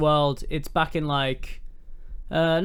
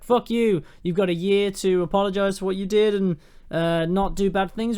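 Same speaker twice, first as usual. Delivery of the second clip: higher and louder, hammed-up vampire fury; mock furious threat; 5 semitones higher, 3 dB louder than usual. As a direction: bellow the ultimatum down from the sky. Dracula threatens from the sky.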